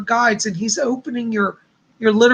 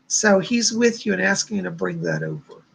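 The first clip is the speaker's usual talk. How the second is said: usual speaking voice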